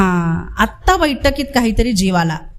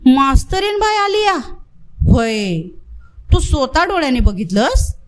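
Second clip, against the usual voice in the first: astonished; 3 semitones higher than usual. Acted. Villager stunned that a woman teacher has come, astonished. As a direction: gasp it out in disbelief.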